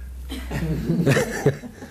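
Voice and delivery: quiet chuckle; soft laugh. Real, not acted. Preacher laughing at his crowd, soft chuckle.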